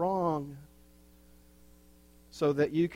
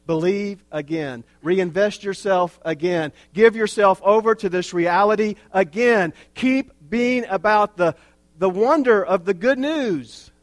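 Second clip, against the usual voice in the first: building from steady to loud preaching; rising exhortation; 5 semitones higher, 9 dB louder than usual. Acted. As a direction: drive the call home, louder each phrase.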